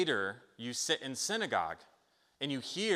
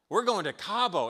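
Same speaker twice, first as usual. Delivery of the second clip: higher, giddy daydream; excited; 8 semitones higher, 4 dB louder than usual. Retold or imitated. Preacher voicing Jebediah dreaming of spending the money, excited. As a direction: sell the thrill of a holiday windfall. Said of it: "and you get excited".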